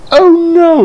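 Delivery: high, loud wailing cry. Acted. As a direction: wail in dismay. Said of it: "wailed Daisy".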